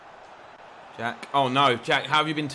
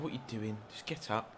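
muttered frustration; 5 semitones lower, 13 dB quieter than usual. Real, not acted. Muttering at fallen player.

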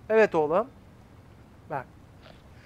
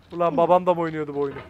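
normal talking voice

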